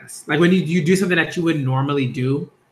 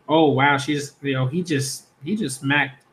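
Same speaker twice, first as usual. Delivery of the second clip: plain reading voice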